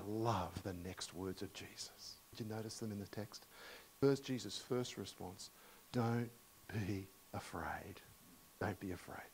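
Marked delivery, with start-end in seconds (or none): 5.9-9.3 gentle reassurance. Retold or imitated. Jesus' gentle words.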